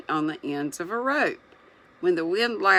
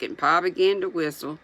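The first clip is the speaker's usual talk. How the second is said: plain reading voice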